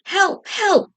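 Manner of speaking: high frantic cries for help; bawling for help. A narrator's reading. Potato bawling as Babette holds him prisoner. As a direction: wail it like a caught prisoner. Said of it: "bawled Potato".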